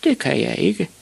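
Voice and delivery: put-on high-pitched child's voice; high, meek reply; timid small boy. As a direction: confess it like a little boy. Ivan answers shouting father.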